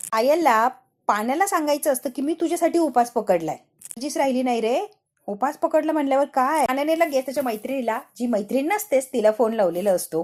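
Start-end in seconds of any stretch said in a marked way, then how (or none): none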